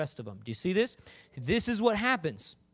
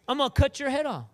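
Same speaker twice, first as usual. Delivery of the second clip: higher, bolder declaration; 8 semitones higher, 4 dB louder than usual. Acted. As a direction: proclaim it like a fearless young warrior. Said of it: "And he was bold".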